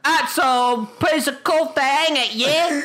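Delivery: high-pitched mock British accent; wife's British impression. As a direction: imitate a terrible British impression, squeakily. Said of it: "her British impression is, like".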